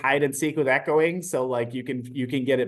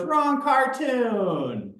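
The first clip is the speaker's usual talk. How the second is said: high, very slow sing-song correction; mock correction; 9 semitones higher, much slower than usual. Adult in a cartoon shirt correcting the teacher in a slow high sing-song. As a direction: stretch out the correction playfully. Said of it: "So she goes".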